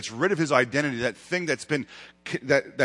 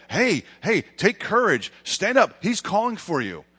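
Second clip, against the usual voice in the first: urging on; 6 semitones higher, slower than usual. Acted. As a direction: play an eager crowd rousing someone to get up. Crowd urges the beggar on.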